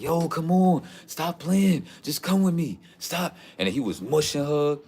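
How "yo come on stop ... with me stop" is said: high, pushy mock boyfriend voice; imitating boyfriend. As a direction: play the guy pleading and pushing her to come. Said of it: "He was like".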